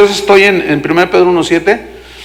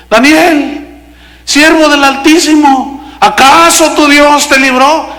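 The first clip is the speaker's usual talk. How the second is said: high, slow, anguished calling out; lamenting cry; 8 semitones higher, slower than usual. Retold or imitated. King calling to Daniel at the lions' den, lamenting cry. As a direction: wail it out slowly, as an acted lament. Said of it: "lamentándose".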